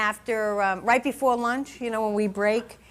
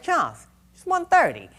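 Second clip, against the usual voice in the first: alarmed; 6 semitones higher than usual. Retold or imitated. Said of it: "I said".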